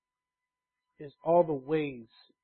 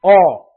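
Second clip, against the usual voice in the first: All said alone, sharply louder; stress on All; 13 dB louder than usual. Shown as a demonstration. Preacher quoting Paul's word to the church, All punched loud. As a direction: hit the word All hard. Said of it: "he says".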